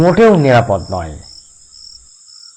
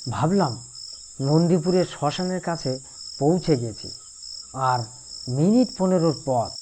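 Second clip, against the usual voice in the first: much softer, tense storytelling; hushed suspense narration; 9 dB quieter than usual. A narrator's reading. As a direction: draw them in with a hushed voice. Eerie climax of ghost story. (চাপা গলায় ভয়ের গল্প বলা)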